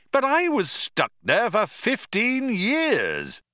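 outraged at the unfairness; Arthur's indignation. A narrator's reading. Arthur protesting to Zaphod, high slow indignant.

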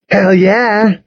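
high-pitched hearty agreement; affirming. A narrator's reading. Jimmy agreeing about hard practice, affirming. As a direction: back your friend up, pitched high. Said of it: "Jimmy affirmed".

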